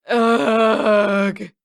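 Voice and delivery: a long, loud whiny groan; bored brother groaning out loud. Acted. Sokka bored on the bison.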